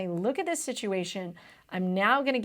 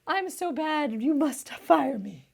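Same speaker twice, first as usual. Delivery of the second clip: high, slow, self-pitying whine; pitiful humble servant; 5 semitones higher, slower than usual. Retold or imitated. Tutor acting Nawab groveling to be fired, high pitiful whine. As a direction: whimper like a hopeless old man begging. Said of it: "trying to be super humble and be like".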